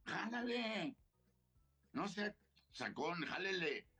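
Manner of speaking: high, taunting pull it; daring the gunman to shoot; laughing off death, goading him high; defiant dare. Retold or imitated. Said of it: "Le digo".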